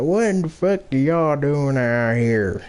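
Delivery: laughing Southern-accented cop impression; mock Southern accent. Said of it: "deep Southern accents".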